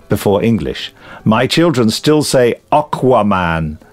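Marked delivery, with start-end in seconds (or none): 2.7-3.8 "Aquaman" starting OCK-wa; American-style Aquaman. Shown as a demonstration. Reader quoting the children's pronunciation, American-style Aquaman. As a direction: say "Aquaman" as OCK-wuh-man. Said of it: "it comes from the Americans saying it".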